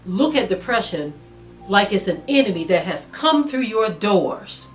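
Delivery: ordinary talk, nothing marked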